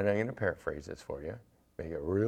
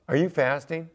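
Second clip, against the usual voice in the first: surprised question; 7 semitones higher, 8 dB louder than usual. Retold or imitated. Friend realizing you're fasting.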